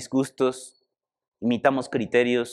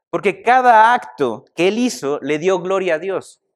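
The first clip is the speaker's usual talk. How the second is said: louder, fervent sermon voice; preaching; 3 semitones higher, 4 dB louder than usual. Acted. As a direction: preach it with rising fervor. Preaching builds to climax. (predicando con fervor, más fuerte)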